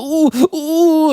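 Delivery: high, drawn-out whimper of needing to go; hammed-up high oohing; urgent "ooh, ooh". Imitating Albert needing the bathroom.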